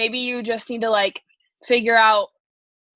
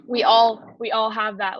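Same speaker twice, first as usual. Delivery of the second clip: ordinary delivery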